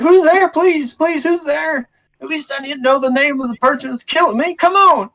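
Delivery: very high, loud, urgent pleading; desperate falsetto cry into the dark; panicked voice. Acted. Stranger demands to know who is in the darkness, panicked. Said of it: "You hear this voice".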